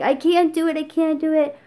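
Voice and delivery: a half-hearted wail of refusal; retold screaming. Refusing to pick up her dead pet, mock screaming.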